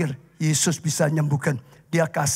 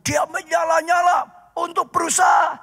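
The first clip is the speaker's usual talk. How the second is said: preaching at full force; 11 semitones higher than usual. Acted. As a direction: drive the story home to the congregation. Preaching about Bartimaeus.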